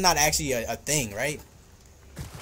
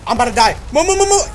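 high, loud panicked move move move; frantic, much higher shouting of move; panicked yelling; 8 semitones higher, 7 dB louder, faster than usual. Real, not acted. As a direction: scream at them to get out of the way. Panicking in combat.

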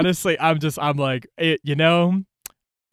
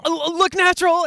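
mock urgent hiss. Urging friends to act casual.

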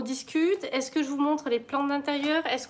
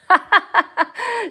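a high, loud laugh bursting out; loud burst of laughter; 10 semitones higher, 9 dB louder than usual. Real, not acted. Laughing at her own messy live stream.

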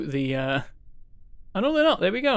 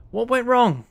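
high-pitched, baffled demand; raised voice of disbelief; exasperated question; 6 semitones higher than usual. Real, not acted. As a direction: demand to know what failed. Baffled right after the crash.

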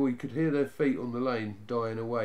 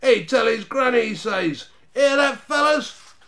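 high, loud jeer; shouted ridicule, rising for the mates; hooting mockery; 10 semitones higher, 11 dB louder than usual. A narrator's reading. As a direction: repeat it to the gang in derision. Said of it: "collapsed in a howl of laughter".

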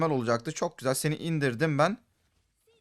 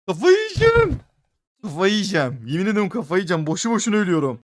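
much higher, loud, frantic wail; exasperated shriek; 5 semitones higher, 9 dB louder than usual. Real, not acted. Gamer dying again and again, shrieking in exasperation. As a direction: lose it completely. Keeps dying, losing his mind.

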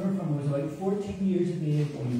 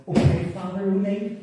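higher, louder mock reply to a priest; respectful churchgoer reply; 6 semitones higher, 5 dB louder than usual. Locals mistaking the Irish accent for a priest's, imitated reply. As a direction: play a local greeting the priest. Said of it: "the reply was".

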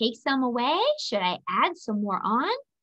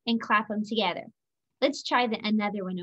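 ordinary delivery

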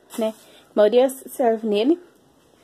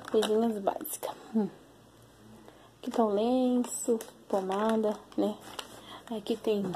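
speaking softly; 11 dB quieter than usual. Real, not acted. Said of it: "Tô falando baixo porque ele tá aqui". (falando baixo)

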